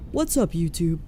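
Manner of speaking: lightly voiced clickbait intro; mock the hyped YouTuber greeting; fake YouTuber voice. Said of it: "I don't like the fake YouTuber voice".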